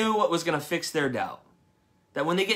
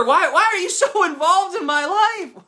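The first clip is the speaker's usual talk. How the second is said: high, loud mock whine of a bothered person; annoyed churchgoer; 12 semitones higher, 8 dB louder than usual. Pastor mocks a congregant complaining about his involvement, high and loud. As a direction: play the person who wants to be left alone, half-laughing. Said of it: "People wonder".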